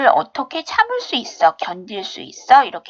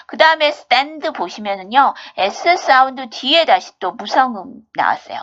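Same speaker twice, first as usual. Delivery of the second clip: ordinary talk, nothing marked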